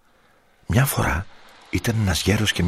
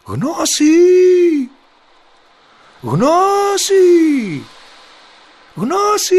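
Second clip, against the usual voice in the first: very high, loud drawn-out call; shouting; 9 dB louder, much slower than usual. A narrator's reading. Boy calls the second fish at the sea, high shout. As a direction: holler the name out to sea. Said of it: "Ύστερα φωνάζει".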